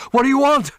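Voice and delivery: high, loud frightened gasp; startled cry at gunpoint; gasped in fear. A narrator's reading. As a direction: blurt it out in sudden terror. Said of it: "he gasped".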